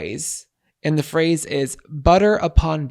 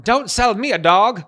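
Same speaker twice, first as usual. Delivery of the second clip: high, loud quoted exclamation; theatrical warning; 7 semitones higher, 6 dB louder than usual. Acted. Presenting last slang to co-host, high theatrical warning. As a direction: deliver the phrase with mock indignation.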